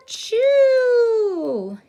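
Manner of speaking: a big, high, loud hammed-up sneeze; huge sneeze. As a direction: sneeze it out like a giant bear. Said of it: "He blows and he sneezes".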